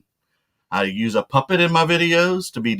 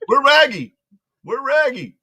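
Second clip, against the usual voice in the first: high cartoon dog voice with R-replaced sounds; worried cartoon dog "Ruh-roh"; Scooby calling for his pal; Scooby-Doo voice; 11 semitones higher, 3 dB louder than usual. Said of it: "it's Scooby Dooby Doo".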